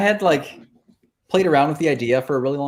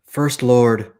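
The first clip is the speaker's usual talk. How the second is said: loud, reverent hail; solemn cultist greeting; 7 dB louder than usual. Retold or imitated. Cultist kneeling before a player character, loud and solemn. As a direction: kneel and declare it with weight. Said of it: "and says".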